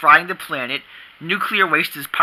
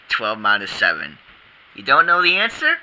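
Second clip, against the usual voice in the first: ordinary delivery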